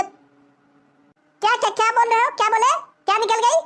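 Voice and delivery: high-pitched, affronted questioning; shocked disbelief. Acted. Woman reacting to a crude remark, shocked.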